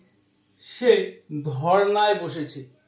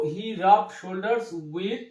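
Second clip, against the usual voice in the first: slow dictation; much slower than usual. Shown as a demonstration. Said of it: "tো he rubbed shoulders with".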